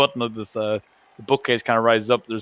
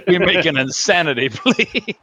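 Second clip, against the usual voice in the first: slow, higher, louder mock courtroom plea; hammed-up chicken lawyer; 6 semitones higher, 4 dB louder, slower than usual. Quoting the cartoon chicken lawyer, slow, raised mock plea. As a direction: ham up the country lawyer. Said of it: "the chicken lawyer in Futurama".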